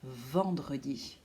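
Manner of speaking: "Vendredi" with a long buzzing "Vvv" at the start; "Vendredi" slowed, its first consonant held; exaggerated first sound. Shown as a demonstration. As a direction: stretch the V opening "Vendredi", slow and low. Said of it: "d'exagérer le début".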